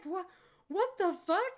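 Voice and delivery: high, loud startled what; baffled shock. Retold or imitated. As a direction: sound blindsided and confused. Curtis reacting, baffled.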